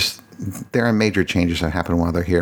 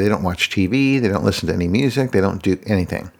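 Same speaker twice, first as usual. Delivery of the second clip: usual speaking voice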